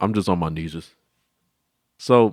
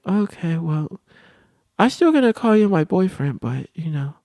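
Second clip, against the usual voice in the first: mock girly voice; girl voicing; 11 semitones higher than usual. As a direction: act out a shy girl asking to be friends.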